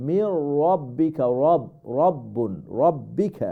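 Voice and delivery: heavy rounded "raw" in "ربك" and "রব"; full-mouth R. Shown as a demonstration. Teacher drilling the r, full-mouthed "ربك". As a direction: round the r each time in "ربك". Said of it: "রাটা ফুল মাফ হবে".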